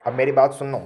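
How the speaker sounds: loud, emphatic summons; calling for attention. Real, not acted. Teacher tells students to listen, loud call for attention. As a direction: raise the voice to pull the class back. Calls class back to attention.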